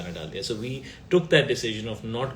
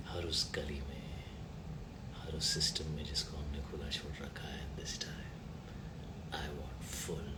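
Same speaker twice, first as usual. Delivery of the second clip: low, much softer, husky threatening whisper; menacing film dialogue; 8 semitones lower, 15 dB quieter, much faster than usual. Shown as a demonstration. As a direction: whisper it like a cop about to take revenge. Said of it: "Say a dialogue from Deva". (धीमी, डरावनी फुसफुसाहट में फ़िल्मी dialogue)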